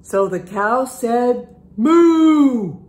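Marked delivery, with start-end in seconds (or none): 1.8-2.8 higher, louder mooing cow. Acted. Reading to kids, cow mooing loudly. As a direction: give a big cow moo.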